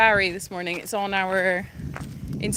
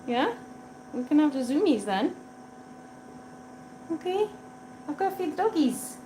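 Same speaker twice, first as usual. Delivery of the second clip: cooing pet talk; 5 semitones higher, 7 dB quieter than usual. Real, not acted. Promising cat zoomies later.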